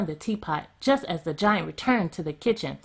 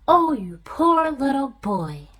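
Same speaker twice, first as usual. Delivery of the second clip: slow, higher, pitying woman's voice; giant's wife; 7 semitones higher than usual. A narrator's reading. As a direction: coo over the poor boy, slowly. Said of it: "said the woman".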